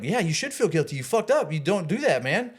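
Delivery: worked-up lecture to a friend; scolding. Retold or imitated. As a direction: tell off a friend who cheated. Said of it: "I'm like".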